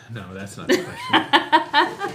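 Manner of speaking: slow, high, laughing through it; laughing at own mistake. Real, not acted. Laughing at own misread question.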